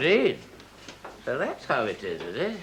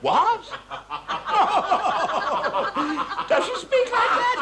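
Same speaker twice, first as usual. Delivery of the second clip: high, incredulous laughter through the words; laughing in disbelief; 9 semitones higher than usual. Acted. Director hearing Madame's broken English, laughing in disbelief. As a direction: burst out laughing while asking.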